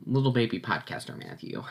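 ordinary talk

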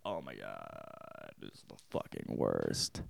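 very low, hushed, slow dismay; acted despair. Reader reacting to a heartbreaking page, acted despair. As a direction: sink into a slow, drained groan of words.

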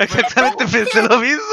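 amused agreement with a laugh; laughing quote. Retold or imitated. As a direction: laugh while quoting yourself. Retold own reaction, laughing.

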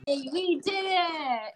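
high, drawn-out cheer; celebratory cheer. Real, not acted. Guest celebrating as the song ranking wraps up, drawn-out cheer. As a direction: stretch it out in triumph.

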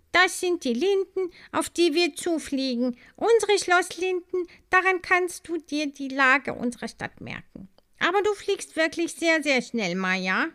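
high, bright guide voice; the honey carrier guiding Maya past the lindens. A narrator's reading. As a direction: point things out as you fly.

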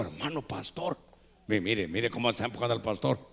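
low, hushed retold voice; mock confiding aside. Friend to pastor in a restaurant, confidingly low. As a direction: lean in quietly, as if tipping off a pal.

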